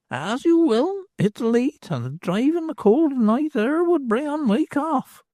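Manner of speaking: put-on a knowing old beldam; old hag's voice. Hag foretells doom.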